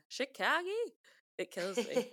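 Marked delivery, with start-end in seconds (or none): 0.1-0.7 Chicago as "shi-KAH-gee", rising; film's odd pronunciation. Retold or imitated. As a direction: lift Chicago into a final "gee". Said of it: "they always say Chicago".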